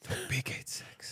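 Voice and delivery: whispered, pleading prayer; desperate whisper. Retold or imitated. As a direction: breathe it like a silent prayer. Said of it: "And I'm like".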